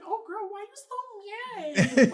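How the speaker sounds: drawn-out, very high teasing voice; mock-concerned taunt. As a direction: needle them with a slow, high, loud tease. Taunting an angry caller.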